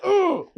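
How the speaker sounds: loud, high pained "ugh"; sudden high winded groan; punched-gut grunt. Retold or imitated.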